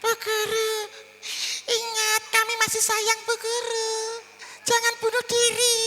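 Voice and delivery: hysterical students. Pretend. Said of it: "siswa Anda histeris".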